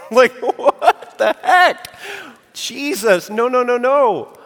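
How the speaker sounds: high, loud mock disbelief; mock outrage. Preacher pretending to protest Jesus' strange remark, mock outrage. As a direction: play up the shock at Jesus' words for a laugh.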